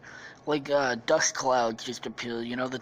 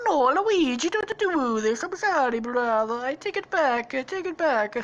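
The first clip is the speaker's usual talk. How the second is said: high, hammed-up cartoon begging; Mario pleading; 10 semitones higher, 4 dB louder than usual. Brother begging at gunpoint, high pleading voice. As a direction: plead urgently in a put-on high voice.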